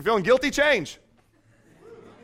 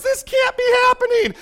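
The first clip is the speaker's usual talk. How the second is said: high, giddy disbelief; mock disbelief; 6 dB louder than usual. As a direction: squeal it like you can't believe your luck. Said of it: "He goes".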